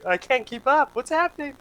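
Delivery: a flustered high yelp; high panicked squeak. Retold or imitated. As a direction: pitch jumps way up, lost. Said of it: "and I'm just like".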